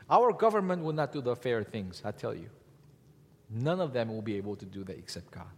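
ordinary delivery

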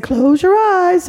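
gentle instruction. Acted. Painter telling the boy to close his eyes, slow and high. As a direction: coax it out slowly.

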